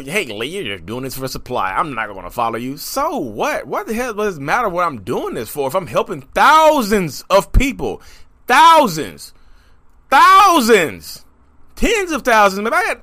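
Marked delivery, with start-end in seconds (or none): 0.0-2.8 mimicked critic. 8.5-11.2 loud, high, drawn-out THOUSANDS; emphatic exclamation. Real, not acted. Boasting to critics about people he helps, emphatic and loud.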